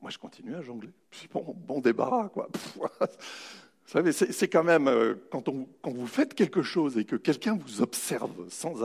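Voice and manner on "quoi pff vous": normal talking voice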